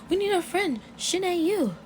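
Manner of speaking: very high-pitched pretend yell; mock yelling. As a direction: call out in a high, hammed-up voice. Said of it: "she's yelling, she's yelling".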